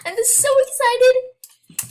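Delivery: very high, loud and slow; shrieking with delight over her cute outfit; excited squeal. Real, not acted. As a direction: squeal it out, barely containing the joy. Showing off her new outfit.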